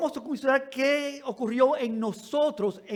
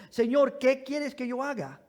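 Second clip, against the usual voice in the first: usual speaking voice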